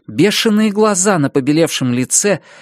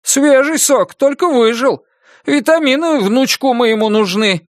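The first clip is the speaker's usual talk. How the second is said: coaxing grandpa; 7 semitones higher than usual. Acted. Grandpa pushing juice.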